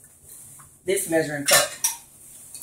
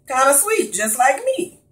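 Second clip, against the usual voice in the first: higher, cheeky self-praise; playful brag; 7 semitones higher than usual. Acted. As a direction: tease them sweetly. Flirty self-praise after honey.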